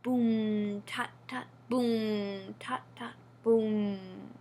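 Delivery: low boom then whispered tut tut; drum rhythm. A narrator's reading. Storyteller voices the council drum, slow drum rhythm. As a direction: beat it out slowly like a drum.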